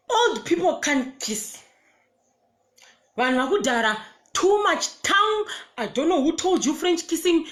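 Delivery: high, disgusted exclamation. Real, not acted. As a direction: squeal it out in disgust. Disgusted rant about the kiss.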